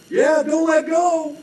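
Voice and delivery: very high, slow, put-on voice; mock carefree reply. Dangling man answering the holder, high, slow, airy. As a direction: float it up airily.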